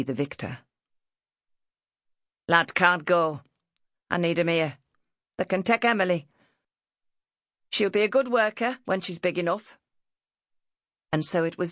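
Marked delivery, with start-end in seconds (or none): 2.5-9.8 firm northern mother, slightly louder; determined mother. A narrator's reading. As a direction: lay down the decision in dialect. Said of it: "in the determined manner".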